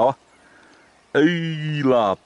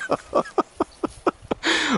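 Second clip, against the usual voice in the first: breathy laugh; 8 semitones higher, 6 dB quieter than usual. Real, not acted. Friend broke the rod.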